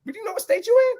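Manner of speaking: high, disbelieving; high mock disbelief at Beto; incredulous. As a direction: ask it like he's lost his mind. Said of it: "I'm like".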